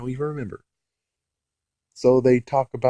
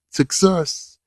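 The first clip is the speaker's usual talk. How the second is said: excited; 8 semitones higher, 5 dB louder than usual. Real, not acted. Cheering the throw.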